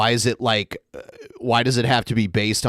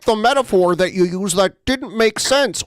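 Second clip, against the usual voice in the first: high-pitched mock praise; mocking echo; 9 semitones higher than usual. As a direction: echo it back in a high, put-on voice. Sarcastically echoes the other speaker's remark.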